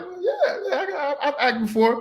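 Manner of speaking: high, giggly overeager yes; laughing bluff. Retold or imitated. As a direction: laugh through a fake-confident claim. Himself bluffing about acting.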